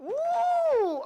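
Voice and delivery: high loud whoop; cheering. Real, not acted. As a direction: whoop with excitement. Cheering at the music.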